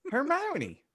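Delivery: high sing-song call up the stairs; calling daughter. Acted. Father calls his daughter down to dinner, high sing-song call. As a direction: call her down, bright and high.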